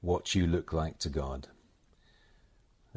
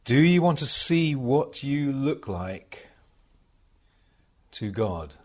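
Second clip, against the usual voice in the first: measured devotional recitation, higher and louder than chat; meditation reading; 7 semitones higher, 9 dB louder, slower than usual. Acted. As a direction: read it as a solemn meditation, pausing between phrases. Reads received passage as a meditation.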